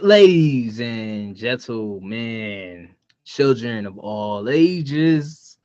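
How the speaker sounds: slow, drawn-out announcer call; ring announcer. Acted. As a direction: stretch it out like introducing the main event. Opens speech like a ring announcer.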